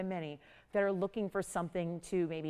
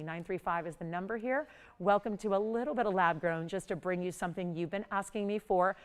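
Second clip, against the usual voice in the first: ordinary delivery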